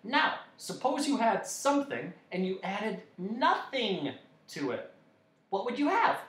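high, eager lecturing voice; explaining dodecahedron. A narrator's reading. Dodecahedron teaching Milo arithmetic, high and keen. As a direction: reason it out brightly in a high voice.

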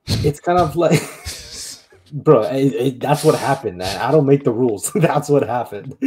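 amused, laughing between the words. Real, not acted. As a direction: protest through real laughter. Cracking up at own joke.

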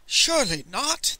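high, startled exclamation; gasped disbelief. A narrator's reading. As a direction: gasp it in mild shock. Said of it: "the merchant gasped".

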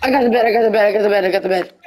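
loud high excited shouting; excited callout. Real, not acted. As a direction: call it out, thrilled.

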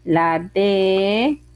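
slow, held syllables with pauses between; syllable dictation. Shown as a demonstration. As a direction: sound it out piece by piece for beginners. Teacher sounds out syllables for writing.

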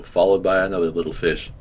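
usual speaking voice

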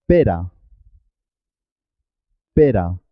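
pera with a single tapped R; simple R. Shown as a demonstration. Teacher gives pera as an example, R single and tapped. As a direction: tap the R in pera once. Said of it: "y suena simple".

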